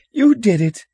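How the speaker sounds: put-on very high, loud child's yell; shrill accusation. Upset little brother blaming the older one, shrill accusation. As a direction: shriek the blame back. Stephen shrieks blame at his brother.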